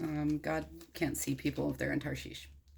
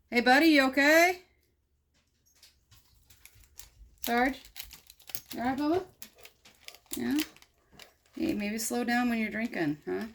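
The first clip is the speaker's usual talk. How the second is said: concerned pet talk; 7 semitones higher, 4 dB louder than usual. Real, not acted. Speaker to his old dog, high fond pet talk. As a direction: check on the dog, fond and worried.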